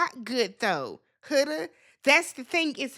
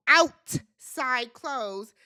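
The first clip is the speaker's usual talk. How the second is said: "outside clothes" drawn out very slowly; stress on outside clothes; much slower than usual. Real, not acted. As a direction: drag out "outside clothes" for emphasis.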